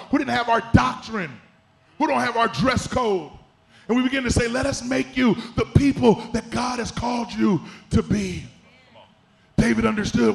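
forceful, emphatic preacher's delivery; preaching. Real, not acted. Preacher urging a church not to discriminate, preaching. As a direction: drive each phrase home like a preacher.

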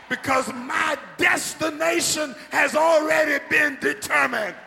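loud, high shouting preacher building up; preaching at full force. Real, not acted. Pastor shouting to the congregation, full force preaching. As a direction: preach it out to the whole church.

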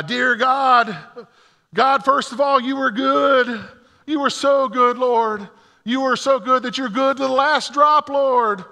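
high, loud hammed-up praying; high, loud comic prayer; mock fervent prayer. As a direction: pray like he's trying to outdo her. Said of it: "I started to pray".

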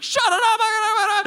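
loud, high rapid babble; mock yelled tongues. Imitating the crowd yelling at him in tongues, loud high babble. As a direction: yell nonsense syllables fervently. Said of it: "they just start yelling at me in tongues".

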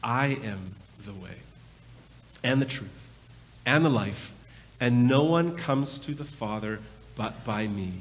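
quiet, tender weight on I am the way; Jesus speaking. Acted. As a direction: hush it down and let each phrase land. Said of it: "Jesus responds to him".

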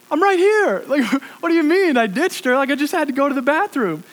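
indignant disbelief. Retold or imitated. Speaker to her friend who says he ditched her, loud indignant disbelief. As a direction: defend yourself, baffled and loud. Accused of ditching his date.

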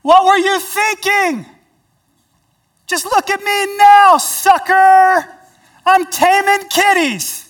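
high, loud, slow gloating yell; hammed-up taunting from the pit; mock yelling taunt. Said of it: "could have yelled out".

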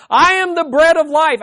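loud, high proclamation; proclaiming call. Retold or imitated. Preacher voicing Jesus calling to the hungry masses in the wilderness, loud. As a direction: announce it to the whole gathered crowd.

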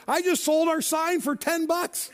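high, slow and thrilled, showing off the deal; gleeful brag. Retold or imitated. Sign holder brags to his buddy, high and gleeful. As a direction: crow about the sale like a kid with a prize.

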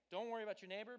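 usual speaking voice

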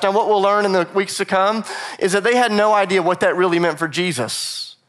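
usual speaking voice

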